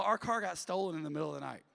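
usual speaking voice

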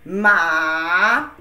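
Ma rising from low; second tone ma. Shown as a demonstration. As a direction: let Ma climb upward. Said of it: "Let's try this".

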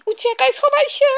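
high-pitched urgent plea; urgent call. Acted. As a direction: push the little creature to flee now. Urging salamander to hide.

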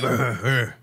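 put-on low gruff old-man grunts; gruff grunt demo. Shown as a demonstration. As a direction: demonstrate short gruff grunts. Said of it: "No, just like".

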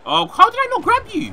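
high, loud wail of disbelief; exasperated yell. Real, not acted. Gamer missing a grab on a wrestler, exasperated high yell. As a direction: cry out at a near miss.

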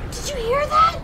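very high excited question; high-pitched, amazed excitement; thrilled squeal. Real, not acted. Thrilled at a game sound.

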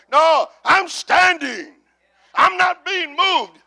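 high, slow, emphatic shout; firm defiant declaration. Shown as a demonstration. Preacher showing how to stand firmly, defiant shout. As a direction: plant every word, refusing to budge. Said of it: "And how do you stand? Firmly".

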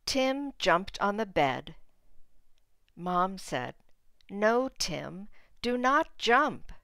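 put-on clear, expressive read-aloud for small kids; reading to children. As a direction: read the picture book to the class. Teacher reads picture book aloud.

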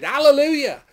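high, loud burst of praise; exultant shout. A narrator's reading. Multitude in heaven shouted again, exultant shout. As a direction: shout it out like a crowd. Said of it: "again they shouted".

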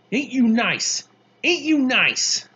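loud, high scolding taunt; high and biting, stretched on the repeat; angry sarcasm. A narrator's reading. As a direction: wake him with a scornful jab. Angry girl wakes boy, mocking.

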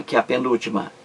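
normal talking voice

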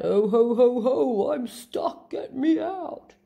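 loud jolly ho ho ho, then stuck; Santa voice. Acted. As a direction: boom it out, then plead. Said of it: "then turns to a shout".

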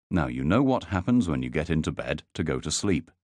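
ordinary delivery

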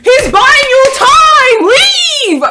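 high, loud, drawn-out yell; frantic shout. Real, not acted. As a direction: yell at them to get out. Yelling at character to flee.

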